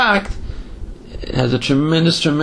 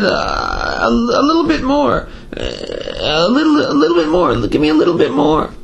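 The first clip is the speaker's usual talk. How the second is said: much higher, urgent begging cry; retold pleading yell; 10 semitones higher than usual. Dreaming man begs the heavenly court for more, high urgent mock yell. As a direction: beg for just a bit more, pitched way up. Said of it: "he jumps up and starts screaming".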